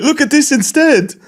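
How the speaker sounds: high, slow put-on show-off; laughing, high mock-seductive plea. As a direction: caricature a streamer flaunting himself, pitched high. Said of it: "He's like".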